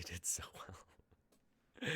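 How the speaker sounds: softly whispered, overwhelmed. Real, not acted. Writer thanking the actors in a whisper. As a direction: breathe out the thanks, touched.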